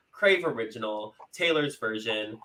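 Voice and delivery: ordinary talk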